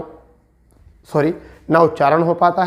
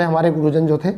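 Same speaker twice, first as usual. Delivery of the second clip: ordinary talk, nothing marked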